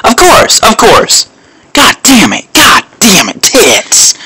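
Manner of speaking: high exasperated yelling; frustrated outburst. Real, not acted. Frustrated outburst losing fight.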